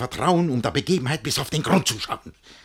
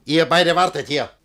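louder curt command; firm order; 6 semitones higher, 9 dB louder than usual. Acted. Master telling both servants to wait, loud and firm. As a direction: leave them with an order.

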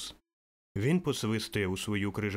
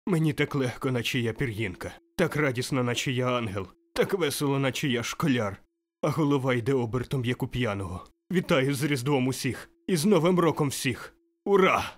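giddy joy; 3 semitones higher, 4 dB louder than usual. Acted. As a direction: bubble over like a schoolboy. (радісне сп'яніння)

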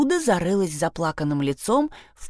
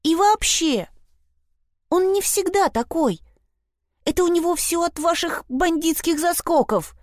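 much higher, agitated defence; exclaimed protest; 11 semitones higher than usual. A narrator's reading. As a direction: argue back in a high, heated voice. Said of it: "воскликнула Фрида". (горячий протест очень высоким голосом)

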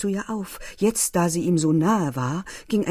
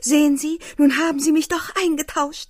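higher and louder, gleeful exclaim; playful cry; 9 semitones higher, 6 dB louder than usual. A narrator's reading. Leni crowing to K. that he traded his girlfriend, gleeful cry. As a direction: call it out in triumph, teasing. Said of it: "rief sie von Zeit zu Zeit".

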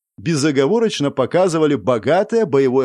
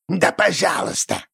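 creaky goblin voice; 4 semitones higher than usual. A narrator's reading. Goblin answers while eating.

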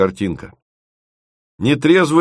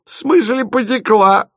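very high-pitched mocking question; guffawing taunt; 12 semitones higher than usual. A narrator's reading. Drunk friend teases the bride, guffawing. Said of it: "захохотал Лёлик".

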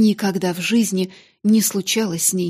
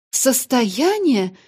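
slow, high stunned cry; stunned exclamation; 4 semitones higher, 4 dB louder, much slower than usual. Acted. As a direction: gasp it out in shock. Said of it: "воскликнула мисс Минчин".

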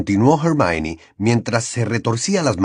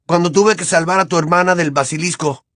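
raised angry voice; 7 semitones higher than usual. Acted. Harry still arguing, turns to Ron.

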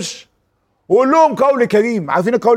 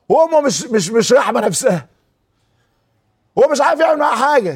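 normal talking voice

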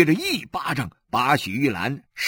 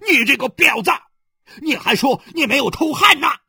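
high, loud abusive yell; furious cursing; 12 semitones higher, 6 dB louder, faster than usual. Acted. Husband abuses his wife after slapping her, raging. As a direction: curse her at full volume. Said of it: "他骂道".

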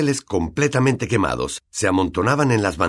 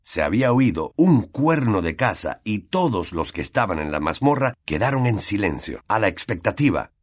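usual speaking voice

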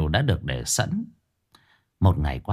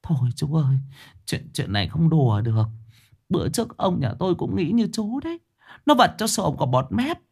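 sniffling; 7 semitones higher than usual. Acted. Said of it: "Bà Hoài sụt sùi".

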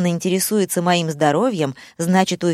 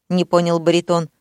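plain reading voice

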